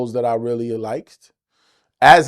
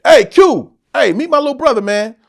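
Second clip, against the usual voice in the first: brother calling out; 8 semitones higher, 5 dB louder than usual. Retold or imitated. Brother calling out to [name].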